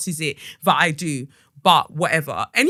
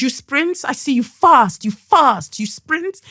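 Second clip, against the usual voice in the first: higher, excited mock-Brazilian accent; poor Brazilian accent; 7 semitones higher, 4 dB louder, slower than usual. Reception guy guessing she sprints, eager Brazilian accent. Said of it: "I can't do a Brazilian accent".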